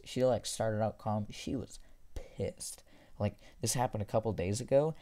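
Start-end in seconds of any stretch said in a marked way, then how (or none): 1.3-2.8 slow, whispered She was pissed; hushed, slow, dramatic whisper; emphatic whisper. Real, not acted. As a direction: whisper each word slowly for weight. Stressing how angry teacher was.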